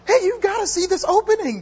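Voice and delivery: very high-pitched neighbor impression; put-on high voice urging someone to watch; Laurie. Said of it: "That's how she talks".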